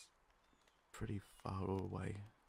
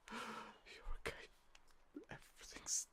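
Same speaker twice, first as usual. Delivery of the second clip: hushed relief; 11 semitones higher, 6 dB quieter than usual. Acted. Checking the stranger over, soft relieved whisper. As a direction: breathe it out gently. Tender reassurance after shock.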